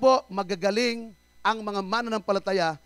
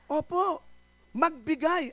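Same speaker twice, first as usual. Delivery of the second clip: ordinary talk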